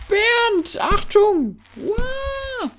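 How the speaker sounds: high, loud warning yell; alarmed shout. Real, not acted. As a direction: yell to warn Bernd. Warning shout at ragdoll rider. (laut warnend rufen)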